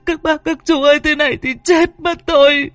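very high, tearful wailing plea; frantic panic. Acted. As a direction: cry out desperately to the neighbours for help. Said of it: "Chị cuống cuồng". (cuống cuồng kêu khóc, giọng the thé)

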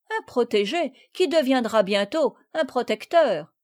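ordinary delivery

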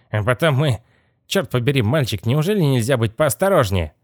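irritated scolding. Acted. Boss telling off a careless boy, mildly exasperated.

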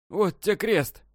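high, frantic swearing; desperate oath. A narrator's reading. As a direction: swear it in panic. Terrified old man swears. (отчаянная клятва, высоко)

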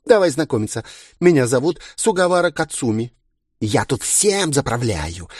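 put-on high, bubbly, self-adoring girl; giddy party girl. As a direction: show off like a pageant queen. Староста представляется, хвастаясь. (игривая самовлюблённая девчонка)

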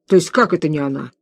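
ordinary delivery

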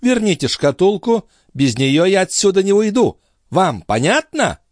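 high feminine ultimatum; demanding countess. A narrator's reading. Countess demanding her casket back, high and insistent. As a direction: insist in high voice, slowing at the end.

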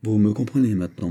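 usual speaking voice

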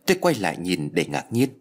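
ordinary delivery

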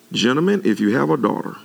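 ordinary delivery

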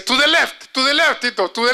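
put-on high, loud child's voice pointing the way; little girl in English. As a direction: call out like an eager four-year-old.

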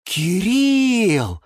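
high, slow delighted exclamation; overjoyed. Acted. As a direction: rejoice for your friend. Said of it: "обрадовался Юра". (радостно)

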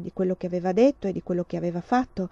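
plain reading voice

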